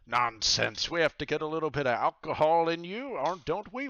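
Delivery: loud, high, fast pirate bluster; insistent pirate captain. Acted. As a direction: insist on the drink heartily. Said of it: "And he says".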